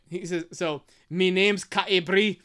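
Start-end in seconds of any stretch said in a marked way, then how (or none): 1.1-2.4 slow, lightly Irish-accented line. Shown as a demonstration. Reader demonstrating the character's dialect, light Irish accent. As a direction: read it in a light Irish lilt. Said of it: "this is my point".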